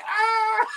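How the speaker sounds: high yelp of shock, laughing; startled yelp. Retold or imitated. Rapper retelling a cicada flying into his mouth, high shocked yelp. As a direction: shriek as the bug hits the mouth.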